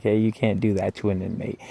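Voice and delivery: ordinary delivery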